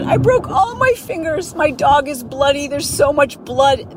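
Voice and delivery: high mock-hysterical voicemail voice; panicked neighbor. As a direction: imitate her overblown panic. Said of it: "She said".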